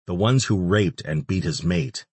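ordinary delivery, nothing marked

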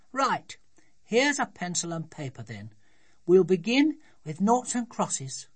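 put-on high, brisk-minded child taking charge; high, loud childish command; bossy little daughter. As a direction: announce the next game as a small girl.

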